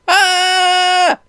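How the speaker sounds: short high yelp of shock; high pained cry. A narrator's reading. Reader voicing Ricardo zapped by lightning, high yelp. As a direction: cry out as the energy hits. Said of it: "cried Ricardo as the energy surged".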